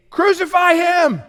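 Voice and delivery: high, slow shout; crowd shouting. Retold or imitated. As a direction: shout it as the mob. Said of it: "They say".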